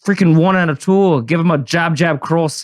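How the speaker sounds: hammed-up Irish brogue; horrible Irish accent. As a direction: greet the audience in a badly faked Irish accent.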